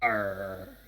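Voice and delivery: low pirate arr. Shown as a demonstration. Asked for a pirate voice, player gives a low arr. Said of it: "as your pirate voice".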